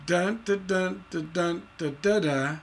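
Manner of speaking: accent the second-to-last syllable, leaving the final "da" unstressed; feminine ending. Shown as a demonstration. Teacher showing students what tension means, chanted feminine ending. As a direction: chant "dun, da" beats, ending on a stressed DA plus a weak "da". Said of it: "the accent is on the second to the last syllable".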